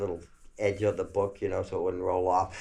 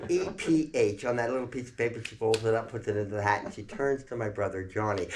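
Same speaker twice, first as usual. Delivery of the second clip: ordinary talk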